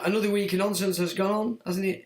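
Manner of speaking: ordinary talk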